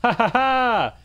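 loud, high "ha ha ha"; big taunting cackle; gloating laugh. Real, not acted. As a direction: crow with triumphant laughter. Gloating over trapped spiders.

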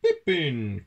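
loud call with a falling tune; cried out. A narrator's reading. Town mouse calling at his friend's door, crying out. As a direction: call the name out sharply. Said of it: "cried a voice".

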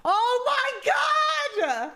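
sudden high shriek of surprise; shocked shout. Real, not acted. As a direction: yelp it out, truly caught off guard. Surprised reaction on live stream.

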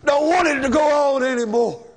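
loud, high, fast burst of grief; anguished outcry. Real, not acted. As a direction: cry it out in real despair. Preacher confesses his own grief.

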